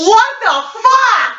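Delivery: high, drawn-out slow outburst; shocked disbelief. Real, not acted. Host hearing a crude definition, stunned and slowly exclaiming. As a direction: stretch it out in laughing shock.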